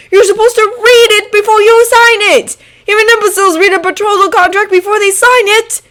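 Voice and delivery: high, loud furious tirade; outraged rant. Acted. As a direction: scold him in shocked outrage. Draco ranting after alarmed cry.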